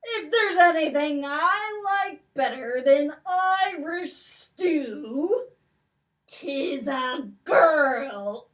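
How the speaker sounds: slow, loud greedy giant; a lightly voiced giant gloating; giant relishing a meal. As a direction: savour it like a hungry giant.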